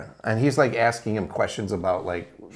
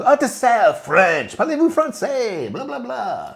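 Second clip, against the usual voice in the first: much higher, louder hammed-up French accent; mock French; 7 dB louder than usual. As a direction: ham a fussy French accent, pitched up. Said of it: "so speaking French to him".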